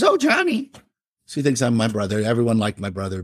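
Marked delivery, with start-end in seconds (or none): 0.0-0.7 put-on high, lilting old neighbor; sweet old lady voice. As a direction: play the dear little old lady next door. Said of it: "And little old lady".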